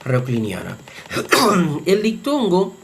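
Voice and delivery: ordinary talk, nothing marked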